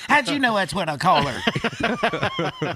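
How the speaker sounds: high, slow and laughing. Real, not acted. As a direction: laugh through the joke. Joking comeback while laughing.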